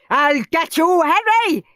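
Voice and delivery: high, loud furious threat; screamed. A narrator's reading. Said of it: "he screamed".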